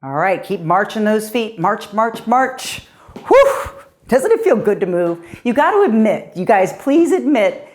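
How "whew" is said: high, loud puff of breath; real post-exercise exhale, high and loud; winded whew. Real, not acted. Instructor winded after marching.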